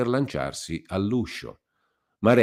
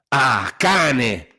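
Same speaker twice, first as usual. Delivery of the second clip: loud, higher furious cry; angry shout; 7 semitones higher, 8 dB louder than usual. A narrator's reading. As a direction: yell it in rage. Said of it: "urlò Renzo". (Renzo urla con furia)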